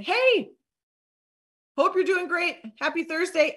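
high, friendly sing-out; lifted, chipper voice; cheery follow-up greeting. Acted. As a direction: greet a prospect perkily. Demonstrating a cheerful follow-up message.

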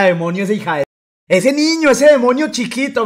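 high, loud hammed-up outrage; accusing mother. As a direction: rant like an indignant mom, mockingly. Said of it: "me decía". (madre furiosa, imitada a gritos)

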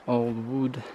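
normal talking voice

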